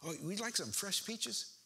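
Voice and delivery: lightly voiced old aunt, eager to please; elderly aunt. Aunt offers her grown nephews peaches, sweet high old-lady voice.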